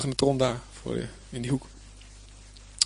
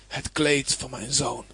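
slow, low-key grieving exclamation; crying out; 4 semitones lower, slower than usual. Acted. Jacob recognising his son's robe, crying out in understated grief. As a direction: draw it out heavily, without shouting. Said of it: "herkende het en riep uit".

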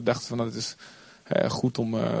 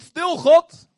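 high, loud yell of stil God; shouting stil God; 9 dB louder than usual. Retold or imitated. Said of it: "als je heel vaak zo doet".

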